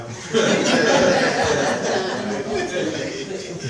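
quiet unvoiced laugh; breathy laugh. Real, not acted. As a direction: let out a soft airless laugh. Laugh at own attitude.

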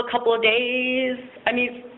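normal talking voice

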